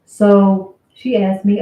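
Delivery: usual speaking voice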